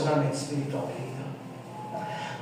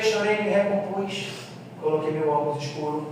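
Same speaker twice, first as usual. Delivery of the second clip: ordinary talk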